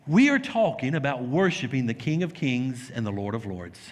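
ordinary talk

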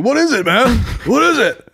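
high-pitched baffled voice; mock American footballer. Giant player handed a tiny shot, mock baffled high voice.

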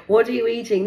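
loud, high nosy daughter; daughter asking. Retold or imitated. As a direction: demand to know. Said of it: "She then said".

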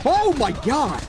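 high, loud exclamation; shocked outburst. Real, not acted. Gamer startled in combat, shocked outburst. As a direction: blurt it out in shock, pitch jumping.

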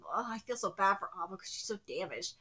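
ordinary delivery

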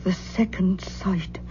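slow, hushed whisper; awed whisper. Acted. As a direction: breathe it out as a dread secret. Aunt fears inherited madness.

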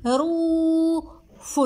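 خروف with رو held long and high, فن apart; syllable by syllable. Shown as a demonstration. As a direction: draw out رو in خروف very slowly. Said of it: "سمعناه في المقطع الأول".

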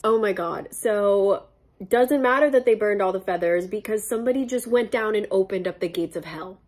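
usual speaking voice

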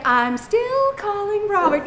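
slow, high sing-song taunt; drawn-out mocking sing-song; taunting phone. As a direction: tease it out like a smug phone. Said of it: "then it gets an attitude".

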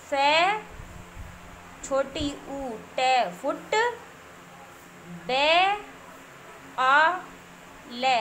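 slow letter-by-letter dictation with pauses; spelling out slowly. Shown as a demonstration. Teacher spelling a word for young children, slow dictation. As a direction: draw out each letter sound for learners.

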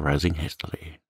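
plain reading voice

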